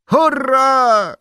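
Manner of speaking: very high, loud hurrah; whooping cry of welcome; jubilant shout. A narrator's reading. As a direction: cheer at the top of your voice. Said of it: "krzyknął zrywając się Pippin".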